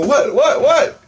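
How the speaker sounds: high, rising, bewildered repeats; startled what. Retold or imitated. Confused reply to his freaked-out friend, high pitched. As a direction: caught off guard, fire it back.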